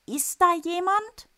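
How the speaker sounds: high, loud anxious call. Acted. Boy calls into the toilet, high and loud. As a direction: call down into the dark. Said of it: "ruft er in die Klomuschel hinein".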